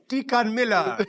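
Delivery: put-on high Jamaican accent. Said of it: "I started doing a Jamaican accent".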